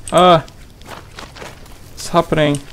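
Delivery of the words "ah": sudden loud yelp of alarm; startled scream. Real, not acted. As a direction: cry out as you get hit. Sudden attack.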